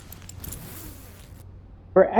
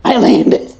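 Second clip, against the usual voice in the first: excited; 4 semitones higher, 8 dB louder than usual. Real, not acted. Lands the ship successfully.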